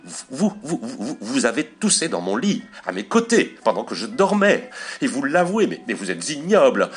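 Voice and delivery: high, sputtering indignation; renewed anger. A narrator's reading. Wife scolds husband who confessed coughing, renewed anger. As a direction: stammer with outrage, punching high on key words. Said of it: "un sursaut de colère nouvelle".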